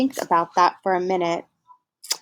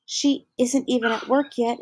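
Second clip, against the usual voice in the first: ordinary talk, nothing marked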